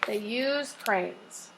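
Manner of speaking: slow, quiet, deliberate; slow dictation. Shown as a demonstration. Teacher to class, slow dictation. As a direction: say it slowly for the children to copy.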